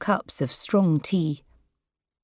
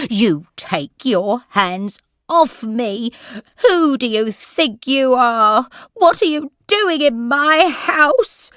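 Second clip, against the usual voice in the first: put-on louder, slow, higher furious old lady; angry shout; 6 semitones higher, 6 dB louder, slower than usual. A narrator's reading. Old lady shouting at carer touching her, angry. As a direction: snap at the carer to let go. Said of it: "she shouted".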